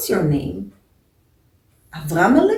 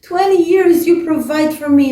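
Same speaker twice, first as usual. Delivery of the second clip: loud, higher wailing cry to the dead; screaming plea; 7 semitones higher, 8 dB louder than usual. Acted. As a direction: cry out his name with the whole congregation. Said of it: "Everyone was screaming in such a loud voice".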